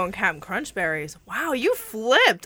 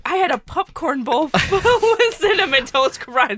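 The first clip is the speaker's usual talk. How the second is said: high, loud mock voice through laughter; mocking imitation; 6 semitones higher, 5 dB louder than usual. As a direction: tease her by parroting her, laughing. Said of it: "Coming from the one who's like".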